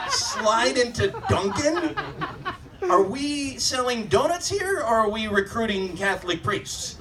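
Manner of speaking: can't believe what you just heard; incredulous. Real, not acted. Repeating tagline in disbelief.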